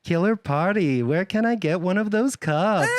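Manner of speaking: attempted American turning Valley Girl, breaking into laughter; lilting California Valley Girl accent. Shown as a demonstration. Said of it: "can't do anything other than a Valley Girl".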